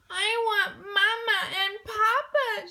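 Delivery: high, loud crying wail. A narrator's reading. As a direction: wail for her parents. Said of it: "she wailed".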